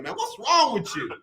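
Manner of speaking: ordinary talk, nothing marked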